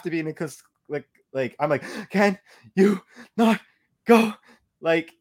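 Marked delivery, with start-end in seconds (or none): 2.1-4.3 pleading. Retold or imitated. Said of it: "pleading with this person".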